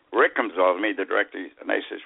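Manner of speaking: normal talking voice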